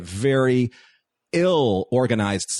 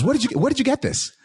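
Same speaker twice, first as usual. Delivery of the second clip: high, fast curious voice; eager question; faster than usual. Retold or imitated. Actor asking the fan where she got the costume, excited. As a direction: ask it eagerly, pitched way up.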